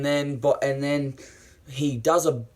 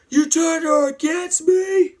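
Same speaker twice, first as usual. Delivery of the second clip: very high, loud shriek of betrayal; theatrical wounded fury; acted furious accusation; 8 dB louder than usual. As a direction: scream it in hammed-up rage. Acts out Anakin's accusation.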